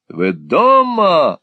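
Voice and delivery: loud, drawn-out shout through the door; shouted call. A narrator's reading. Folair shouting at the door, slow loud call. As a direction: bellow it slowly from the landing. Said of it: "орал мистер Фоллер".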